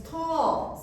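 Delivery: TALL drawn out long and high; emphasized tall. Shown as a demonstration. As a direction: stretch TALL upward. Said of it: "so you emphasize your gestures".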